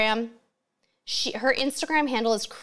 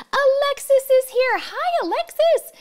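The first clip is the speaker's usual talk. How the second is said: high, loud sing-out hello; excited greeting; 5 dB louder than usual. Real, not acted. Livestream host spotting a viewer arrive, excited greeting. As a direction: greet them in a high, delighted squeal.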